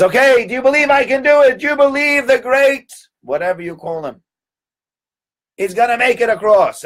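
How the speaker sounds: high, loud ringmaster call to the crowd; showman's call. Retold or imitated. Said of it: "And he says".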